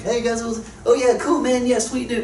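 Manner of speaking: high, overeager "hey guys, sweet dude"; awkward circle dweller. Retold or imitated. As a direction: barge in too eager to belong. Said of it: "the one guy that walks in".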